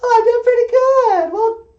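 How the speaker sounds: very high, loud female puppet; high puppet show host; Emily. Acted. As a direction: answer in a loud, high falsetto. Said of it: "Emily, you have a call".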